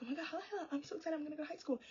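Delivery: high, gushing mock excitement; mock excited. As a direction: imitate a giddy friend. Mocking Elena's excitement.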